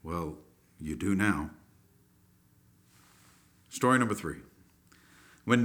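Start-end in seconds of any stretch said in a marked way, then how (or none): 0.0-1.5 low, soft sheepish mumble. Acted. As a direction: admit it quietly, embarrassed. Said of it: "the knight sheepishly answered".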